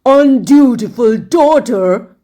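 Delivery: a father outraged at his daughter; angry shout. A narrator's reading. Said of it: "shouted the wicked king".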